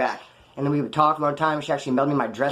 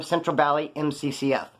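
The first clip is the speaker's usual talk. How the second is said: ordinary talk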